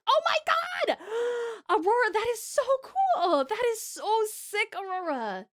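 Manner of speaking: high, thrilled squeal over the art; excited gushing. Real, not acted. Streamer marvelling at a viewer's artwork, excited gushing. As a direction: gush high, stretching out the praise.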